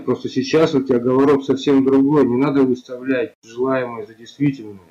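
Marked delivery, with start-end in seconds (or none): none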